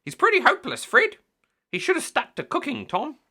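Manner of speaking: very high, loud squawky chatter; seagull voices. A narrator's reading. Seagulls mock Mister Grinling from above, very high squawky chatter. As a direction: chatter like gossiping gulls. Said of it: "The seagulls say".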